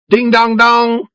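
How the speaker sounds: high, slow ding-dong like a ship's bell; bell imitation. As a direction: ring it out like a boat bell. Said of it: "like a bell".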